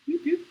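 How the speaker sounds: high playful pew pew laser noise; mock laser zap. Teacher zapping away PowerPoint, playful laser sound. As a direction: zap the slides away like a toy gun.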